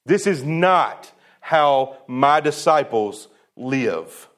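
very slow, each word pressed down; emphatic. Shown as a demonstration. Preacher giving Jesus's firm rule for disciples, slow and emphatic. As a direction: drag it out firmly, no give. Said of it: "That's how he says it".

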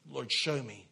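ordinary delivery

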